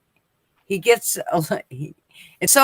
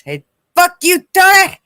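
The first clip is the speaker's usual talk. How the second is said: much higher, loud quoted jeer; mock insult shout; 10 semitones higher, 7 dB louder than usual. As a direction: yell the catchphrase at Tony. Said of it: "that one where he says".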